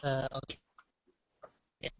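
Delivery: breaking up, faint line; barely audible. Real, not acted. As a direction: voice cutting out on a bad connection. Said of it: "we're really losing you".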